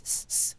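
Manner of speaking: soft voiceless hissing sound; imitated static hiss. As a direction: hiss like mic fuzz. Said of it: "thing that you can hear in my voice".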